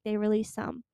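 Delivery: normal talking voice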